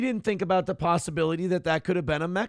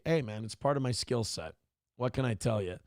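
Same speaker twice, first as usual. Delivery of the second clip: usual speaking voice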